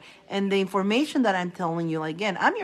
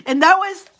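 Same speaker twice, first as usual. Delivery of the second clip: laughing; 12 semitones higher, 7 dB louder than usual. Real, not acted. Laughing, still worked up.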